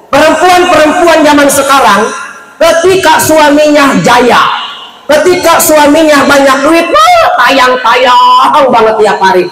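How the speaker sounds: mock the greedy wife at full pitch; mocking fickle wife. Mocking fickle modern wives.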